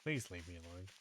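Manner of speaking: hushed, pleading under the breath; whispering while hiding. Real, not acted. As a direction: whisper so it can't hear you.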